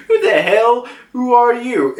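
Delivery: high, laughing indignant squawk; annoyed Pepe. Retold or imitated. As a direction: demand who this stranger is, half laughing. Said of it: "Pepe's like".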